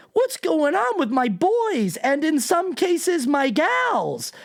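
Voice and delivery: high-pitched, drawn-out incredulous wail; mock presidential outburst. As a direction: put on a high, slow, mocking plea. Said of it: "for the president of the United States to start a post by going".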